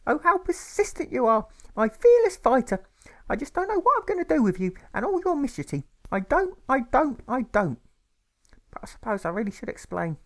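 very high, loud, sing-song mother insisting; a grieving mother clinging to hope; tearful mother. A narrator's reading. Mother reassures her son about his father, high and loud.

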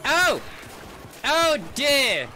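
high startled cry. Real, not acted. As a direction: jump at the scare, cry out. Startled by a monster.

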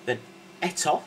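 ordinary talk, nothing marked

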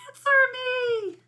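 very high, loud, stretched call; crying out. A narrator's reading. Buzz begs his missing fly to answer, crying out. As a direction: wail it out across a distance. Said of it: "he cried".

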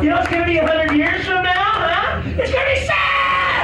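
high shouted tirade; hammed-up exasperated yelling; furious rant. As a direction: scold them at full volume. Ranting at desert villagers.

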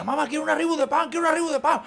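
put-on high-pitched, insistent child's begging; nagging kid. Teenager begging mamá for Reebok trainers, mock pestering whine.

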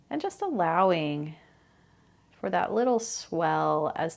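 calm, unhurried meditation guide with long pauses; guided meditation. Real, not acted. Host guiding listeners through mindfulness of breathing, calm guided meditation. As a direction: ease listeners into the breath, phrase by phrase.

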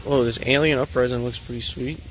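ordinary talk, nothing marked

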